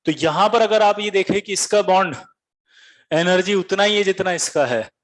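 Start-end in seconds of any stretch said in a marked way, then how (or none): none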